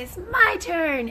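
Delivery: put-on high, slow whiny kid demanding his turn; mock little boy insisting; child's demand. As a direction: stamp your foot like a toddler.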